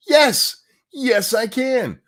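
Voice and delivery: first yes punched high and loud, then slow; emphatic yes. Real, not acted. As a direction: hammer the answer home. Answering the mocked question emphatically.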